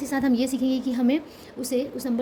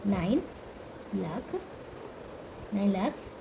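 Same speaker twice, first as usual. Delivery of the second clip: spaced out, word by word; 3 semitones lower, 7 dB quieter, slower than usual. Shown as a demonstration. Teacher spacing out nine लाख for the class, slow and separate. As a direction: say nine, pause, then लाख with soft low fall. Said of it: "बोल सकते हैं कि nine लाख".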